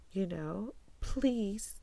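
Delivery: ordinary delivery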